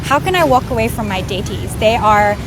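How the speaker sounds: normal talking voice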